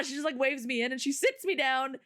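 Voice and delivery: ordinary talk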